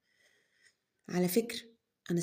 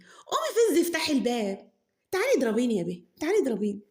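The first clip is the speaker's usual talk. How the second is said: sarcastic mother; 5 semitones higher, 5 dB louder than usual. Acted. Mother mocks daughter, laughing.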